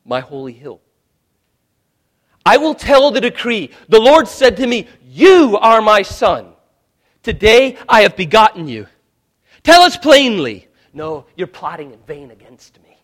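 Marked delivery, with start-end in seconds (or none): 2.4-8.9 loud, rising pulpit thunder; fiery sermon delivery; preaching at full force. Acted. 9.6-10.7 loud, high, falling demand; loud, hammered demand; shouted demand. Retold or imitated. Same quote said twice.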